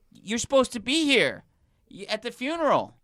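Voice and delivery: loud, high-pitched frantic complaint; imitated panic, voice shooting up; panicked caller. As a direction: act out a freaked-out client on the phone. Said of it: "It's a panic call".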